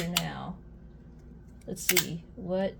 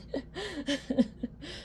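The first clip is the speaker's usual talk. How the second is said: high, quieter laugh fading away; soft trailing laugh; 8 semitones higher, 6 dB quieter than usual. Real, not acted. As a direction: let the laugh die down.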